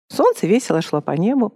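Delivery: ordinary delivery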